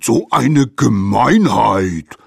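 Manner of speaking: slow, loud indignant bellow; snorting outrage. A narrator's reading. Bitten mammoth protests the attack, indignantly bellowing. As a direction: roar the complaint, drawn out. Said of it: "schnaubte das Mammut".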